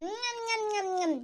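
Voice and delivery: soft, repeated eating hums; munch and hum with pleasure; quiet nom-nom noises; relishing munching. Acted.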